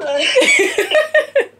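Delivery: bursting into high laughter; loud laugh. Real, not acted. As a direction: let out a big, high laugh. Laughs after the teasing.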